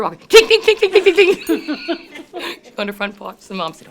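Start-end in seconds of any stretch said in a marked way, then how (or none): 0.3-2.6 high-pitched, rattling clinks; noisy comic clink-clink-clink; loud imitated clinking. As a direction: mimic cans clanking wildly, loud and high. Cans rattling as she walks off.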